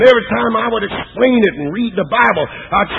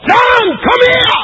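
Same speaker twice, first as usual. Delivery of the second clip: calling out; 4 dB louder, slower than usual. Acted. Jesus calls from the cross.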